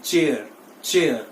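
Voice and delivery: चेयर with final R sounded; common mispronunciation. Shown as a demonstration. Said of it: "हमेशा ऐसे ही बोलते आए हैं".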